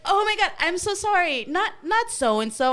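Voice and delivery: high, loud mock apology; flustered apology. Aunt correcting the cousin's name, flustered.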